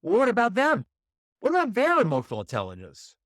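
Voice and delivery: high, loud mock protest; hammed-up indignant question, high and loud; imagined objection. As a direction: play the listener who objects. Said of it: "You're saying".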